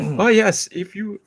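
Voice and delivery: failed attempt at LT's accent. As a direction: try LT's accent and give up mid-line. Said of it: "I can't do his accent".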